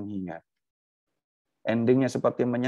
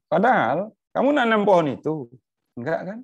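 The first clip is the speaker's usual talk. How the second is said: higher, louder pointed divine reproach; God challenging Jonah, raised and insistent; God rebuking Jonah; 10 semitones higher, 4 dB louder than usual. Acted. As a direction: press the point as God correcting a sulking prophet. Voices God scolding Jonah.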